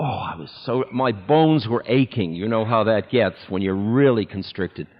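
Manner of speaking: ordinary talk, nothing marked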